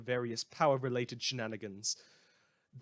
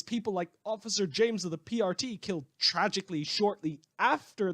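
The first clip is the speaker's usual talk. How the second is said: rising, louder, heated rant; carried away; 7 semitones higher, 5 dB louder than usual. Real, not acted. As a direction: get worked up and carried away, voice climbing higher and louder. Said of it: "I got a little carried away there".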